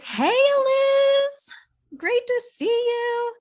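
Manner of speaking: light up as a friend walks in; delighted greeting. Real, not acted.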